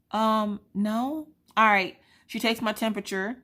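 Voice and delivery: normal talking voice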